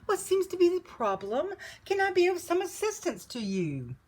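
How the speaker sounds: high, courteous offer of help; polite. A narrator's reading. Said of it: "bowing politely".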